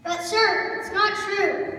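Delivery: loud, insistent objection; loud protest. Acted. Young scout correcting the announcer, loud protest. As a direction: object loudly to the man in charge.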